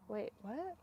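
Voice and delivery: ordinary talk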